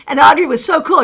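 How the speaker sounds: normal talking voice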